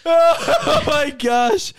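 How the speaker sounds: slow, loud laugh-filled oh my gosh. Real, not acted. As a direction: crack up while saying it. Laughing fit after food story.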